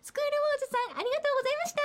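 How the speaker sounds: high-pitched put-on cutesy thanks; mock cute voice. Co-host thanking the guest in playful high cutesy voice. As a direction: sign off in an exaggerated sweet high voice. Cute moe imitation sign-off, then teased.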